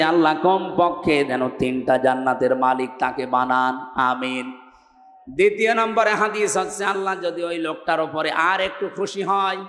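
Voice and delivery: ordinary delivery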